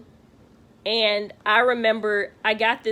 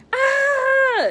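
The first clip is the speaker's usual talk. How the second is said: high, loud imitated scream of pain; sharp shouted cry; retold scream; 6 dB louder than usual. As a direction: let out a piercing birthing scream. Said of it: "I let out this scream".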